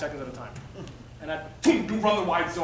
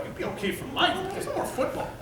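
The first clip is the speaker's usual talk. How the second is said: higher voice breaking into a laugh; laughing doctor; 11 semitones higher than usual. Retold or imitated. Doctor laughing off his hopes of playing. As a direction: chuckle through the bad news. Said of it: "He's laughing".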